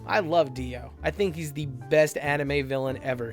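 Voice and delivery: ordinary delivery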